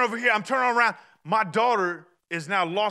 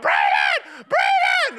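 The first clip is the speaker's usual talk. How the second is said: put-on loud, high-pitched yell for a lost child; acted-out desperate holler; frantic calling out; 7 dB louder, much slower than usual. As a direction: shout the name across a crowd in panic. Acts out searching for lost daughter.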